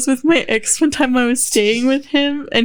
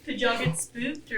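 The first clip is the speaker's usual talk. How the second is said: spooky hush; 13 dB quieter than usual. Real, not acted. Teasing spooky question.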